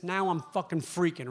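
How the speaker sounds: ordinary talk, nothing marked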